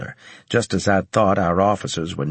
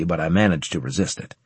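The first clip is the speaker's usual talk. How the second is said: plain reading voice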